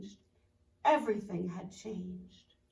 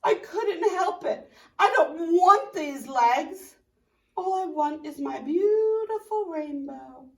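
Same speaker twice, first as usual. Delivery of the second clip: pleading; 6 semitones higher, 7 dB louder than usual. Acted. Tadpole pleads with caterpillar.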